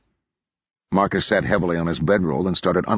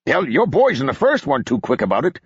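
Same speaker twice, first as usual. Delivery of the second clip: put-on sharp, higher mountain-accent snap; snapping; 6 semitones higher than usual. A narrator's reading. Moore retorting to Marcus's insult, sharp mountain-accent snap. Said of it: "Moore snapped".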